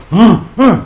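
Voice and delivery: high, loud mock pants of the wife; loud panting moans. Wife in bed, loud mock panting huffs. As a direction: ham up her breathless huffs. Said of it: "She go".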